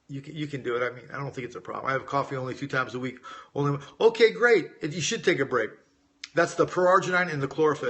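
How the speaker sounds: ordinary delivery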